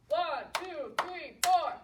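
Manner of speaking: punchy, loud count. Shown as a demonstration. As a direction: count out each clap for the children. Counts claps aloud for children.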